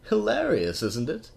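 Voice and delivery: high, loud delighted burst; gleeful. Real, not acted. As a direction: crow with mock delight. Gleeful remark on Derpy's plight.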